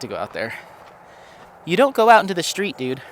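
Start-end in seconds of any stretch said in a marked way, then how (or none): 1.6-3.0 fast, firm scolding of the dog; stern dad telling the dog off; dad mode. Real, not acted. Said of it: "Dad mode kicking in".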